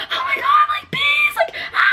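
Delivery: high, frantic shriek about the bees; panicked screaming. Retold or imitated. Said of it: "I'm, like, screaming".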